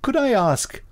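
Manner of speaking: high mock voice. Host mimics the film character's questioning.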